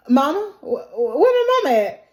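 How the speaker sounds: high, loud stammering call for mama; frantic little boy looking for his mother; rising panic in a child's voice; panicked child. Acted. Boy searching for missing mother.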